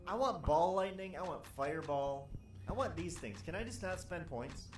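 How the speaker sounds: whining demand. Real, not acted. Whining for spells.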